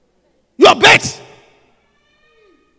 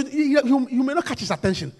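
ordinary talk

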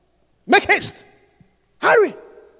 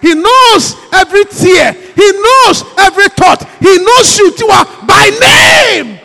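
slow, louder shouted preaching; 5 semitones higher, 7 dB louder, slower than usual. Real, not acted. Preacher climaxes, shouting to the congregation slowly. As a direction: shout it out, stretching knows. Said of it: "preaching at full force".

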